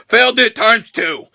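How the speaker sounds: normal talking voice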